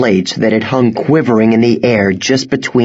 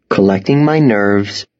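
ordinary delivery

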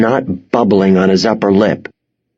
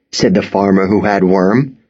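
ordinary delivery, nothing marked

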